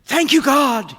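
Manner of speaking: very high, loud cry of relief; exultant, full-voiced yell; overjoyed shout. A narrator's reading. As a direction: shout it to the heavens, elated. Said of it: "clear display of emotion".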